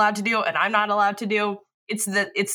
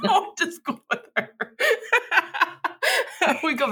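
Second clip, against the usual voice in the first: high-pitched, through laughter; laughing; 8 semitones higher than usual. Real, not acted. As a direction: laugh your way through the line. Laughing at the name mix-up.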